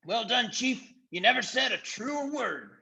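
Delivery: very high, eager voice; fawning follower. Acted. Follower praising the chief, very high and fawning. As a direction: flatter the boss.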